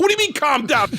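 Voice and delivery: high, indignant comeback; losing his shit. Retold or imitated. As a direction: imitate an outraged father snapping back at security. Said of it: "My father started losing his shit".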